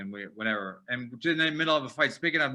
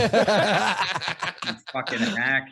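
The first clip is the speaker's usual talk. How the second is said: laughing insult; 4 semitones higher, 5 dB louder, much slower than usual. Retold or imitated. Mocking stripper's line, laughing.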